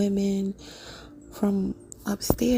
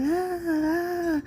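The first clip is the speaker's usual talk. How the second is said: high, short imitated child's screams; sharp, pitched-up frightened yelps; mock scared screams; 8 semitones higher, slower than usual. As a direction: cry out like a panicked little girl. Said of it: "she just started screaming".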